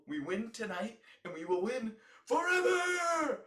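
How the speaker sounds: high, loud and slowed down; rally the crowd with it; fired-up shout. Real, not acted. Fired-up rallying cry to fans.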